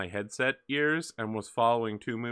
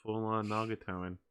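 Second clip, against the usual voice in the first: usual speaking voice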